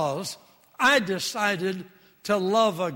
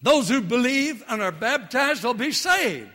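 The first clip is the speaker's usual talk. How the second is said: higher, louder scripture proclamation; scripture reading; 5 semitones higher, 6 dB louder than usual. Acted. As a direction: proclaim the verse. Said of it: "quoting scripture".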